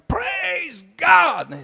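high shout from up on the crane; shouted praise. Retold or imitated. Preacher recalling shouting praise from the crane, a high shout. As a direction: call it out from the crane. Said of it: "they see me up on crane".

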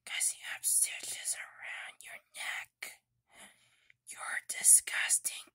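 hushed whisper to a toy; quiet secretive whisper. Acted. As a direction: breathe it softly, no voice.

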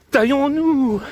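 high, drawn-out cry of alarm; anxious wife. Acted. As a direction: call it out high, like a worried wife. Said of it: "inquiète, lui disait".

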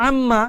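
আম্মা with a brief doubled মম, no hum; quick clipped আম্মা; short tashdid, no ghunnah. Shown as a demonstration. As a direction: clip আম্মা quickly, old way. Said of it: "এখন আর এভাবে পড়া যাবে না".

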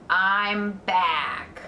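loud, put-on rat voice; rat character voice. A narrator's reading. Rat drags himself back into the pen, loud announcement.

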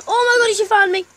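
high, loud panicked yell; panicked shout. Real, not acted. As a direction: shout as you're caught. Caught by Granny.

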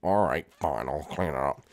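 put-on low, soft childish Jeffy voice. Jeffy answers his parent, low sulky kid voice. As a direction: mumble it like a stubborn kid.

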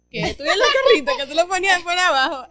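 high, loud, laughing gush; laughing excitement. Real, not acted. Gushes about street-racer lights, laughing. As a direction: squeal with laughing delight.

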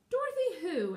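high, loud question; baffled shout upward; puzzled call. A narrator's reading. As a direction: call up in surprise. Scarecrow calls up, puzzled.

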